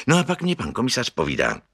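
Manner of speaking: plain reading voice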